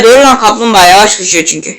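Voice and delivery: ordinary talk, nothing marked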